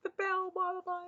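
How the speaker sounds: high, bright excited call to husband. Retold or imitated. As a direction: squeal it like a kid showing off. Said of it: "I was, like, all excited".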